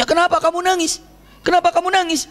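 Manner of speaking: high-pitched acted questioning; companions asking. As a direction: ask as concerned companions, pitched well up. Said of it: "Para sahabat bertanya". (para sahabat bertanya dengan nada tinggi)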